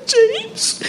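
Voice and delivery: very high, soft gasp of a name; mom's cry of relief. Retold or imitated. Mother hearing her son's voice, high and overjoyed. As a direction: call his name in a high burst of relief. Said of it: "Y yo".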